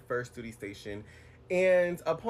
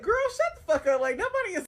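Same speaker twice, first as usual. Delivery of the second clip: high, loud brush-off; dismissive retort; 5 dB louder than usual. Retold or imitated. Shutting down the shirtless coworker, high sassy brush-off. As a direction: shut him down with a shrill sassy laugh-off.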